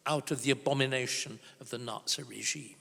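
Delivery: normal talking voice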